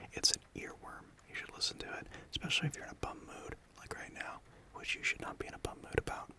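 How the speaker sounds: gentle close whisper throughout; cozy ASMR whisper. Real, not acted. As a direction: whisper close to the mic like comforting a friend. Whispers to comfort listener.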